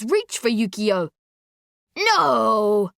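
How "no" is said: drawn-out wailed protest; long mournful cry; old man's wail. A narrator's reading. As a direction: wail it like a distressed old man. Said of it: "one old man wails".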